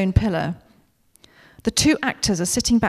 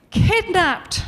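higher, louder melodramatic cry; hammed-up tragic actress; 8 semitones higher, 4 dB louder than usual. Suze performing to the camera on set, big melodramatic cry. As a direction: play it to a massive London stage. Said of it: "says Suze again".

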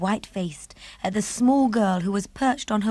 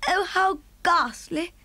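higher, slow horrified cry; cried out in dismay; 9 semitones higher, slower than usual. Acted. Queen reacting to the bones news, a slow horrified cry. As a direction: let the horror sink in. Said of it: "the famous voice cried out".

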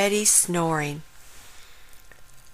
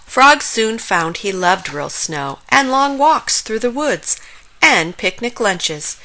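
usual speaking voice

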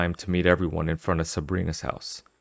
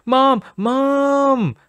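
calling out in fear; 6 dB louder than usual. Acted. Boy screaming for mother.

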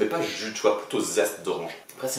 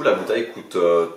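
ordinary talk, nothing marked

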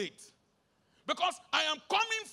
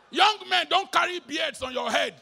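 loud call; 4 semitones higher, 7 dB louder than usual. Real, not acted. Preacher to young men, loud warning. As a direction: call them out loudly.

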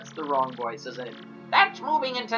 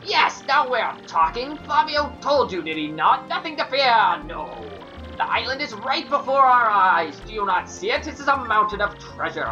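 affected fluting fop. Acted. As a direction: brag like a vain dandy. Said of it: "Flavio's voice".

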